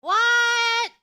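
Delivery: surprised. Pretend. Reacting to phone rule.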